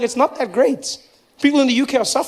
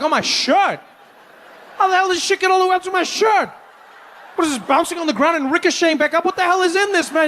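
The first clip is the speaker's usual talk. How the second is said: outraged yelling; 8 semitones higher than usual. Retold or imitated. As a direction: yell it in outrage at the taco guy. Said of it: "I'm like".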